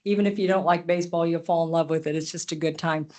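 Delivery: ordinary talk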